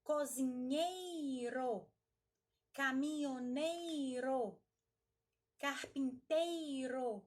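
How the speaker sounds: "cozinheiro, caminhoneiro, carpinteiro" with a long, strong "ei"; teacher stretching the stressed "ei" of each profession; strong syllable drawn out. Shown as a demonstration. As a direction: drag out the "ei" in each word, slowly. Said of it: "aquela palavra que nós mais arrastarmos".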